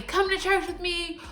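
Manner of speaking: high-pitched mock yell; retold yelling. Stranger urging a busy passerby to church, mock yelling. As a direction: imitate an insistent holler without full volume. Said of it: "No, he is yelling".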